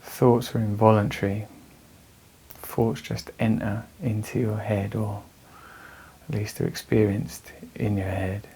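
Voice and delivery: measured meditation voice with long pauses; guided meditation. Real, not acted. Teacher guiding anxious listeners through breathing, calm meditation voice. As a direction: guide them calmly through the breath, leaving space between phrases.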